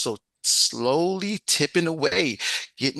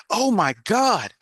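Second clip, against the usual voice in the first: higher, louder, drawn-out oh my God; a touch of startled panic; mock alarmed gasp; 7 semitones higher, 5 dB louder, slower than usual. Storyteller voicing John as the lioness returns, mock alarm.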